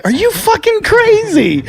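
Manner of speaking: very high, stretched-out mock outrage; incredulous outburst. Comic calling the man back on the phone, high incredulous outburst. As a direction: act out yelling in disbelief, pitch soaring. Retold outburst at caller on redial.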